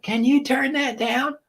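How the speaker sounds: put-on high, slow kid voice asking; imitating son. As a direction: plead like a kid over loud music. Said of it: "He'll be like".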